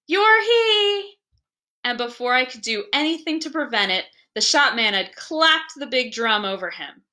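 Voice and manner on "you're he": put-on high, bright child calling out; a little boy tagging his father in play; child's cry. A narrator's reading. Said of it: "cried Gip".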